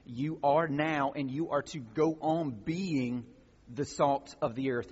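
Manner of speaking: "are" and "now" slowed and pressed, each word weighted; slow emphatic reading. Shown as a demonstration. Preacher unpacking the Greek tense, slow and emphatic. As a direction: spell out "You are now" slowly, leaning on "are". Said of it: "the tense of that means now and continuing".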